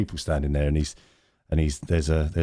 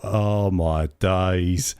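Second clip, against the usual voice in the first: long drawn-out exasperated thought; slow, incredulous aside; dismayed disbelief; much slower than usual. Retold or imitated. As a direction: stretch every word in dismay. His dismayed thought on overhearing the boast.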